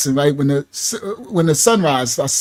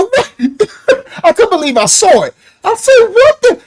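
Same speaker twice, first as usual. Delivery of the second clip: high, laughing what the; laughing shout of disbelief; stunned disbelief; 10 semitones higher than usual. Retold or imitated. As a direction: blurt it out in shock. Stunned by bird strike.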